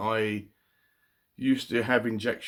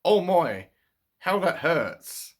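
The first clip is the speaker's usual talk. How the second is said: much higher, slow "how that hurts", poking fun; mock prim exclamation; 7 semitones higher, 4 dB louder, slower than usual. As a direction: parody an overly polite ouch. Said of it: "rather than saying".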